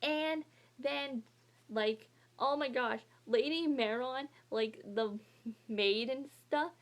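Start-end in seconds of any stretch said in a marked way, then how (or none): none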